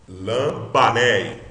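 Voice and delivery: Lambané drawn out slowly with the accent on "ba"; stress on BA. Shown as a demonstration. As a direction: lean on the "ba" of Lambané. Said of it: "a sílaba forte é o ba".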